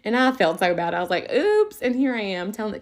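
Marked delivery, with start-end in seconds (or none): 1.3-1.8 high, louder sheepish oops; embarrassed oops. Retold or imitated. Teacher realizes she rushed the hurt boy, high sheepish oops. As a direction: blurt the oops as it dawns.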